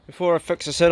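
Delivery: ordinary talk, nothing marked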